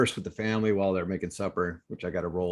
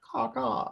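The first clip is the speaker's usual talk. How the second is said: high cawing like a crow; crow caw imitation; 13 semitones higher, 3 dB quieter than usual. Imitating the eleven crows overhead, high crow caws. Describing crows crowing like crazy.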